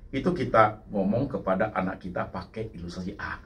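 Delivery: usual speaking voice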